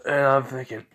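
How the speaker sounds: ordinary talk, nothing marked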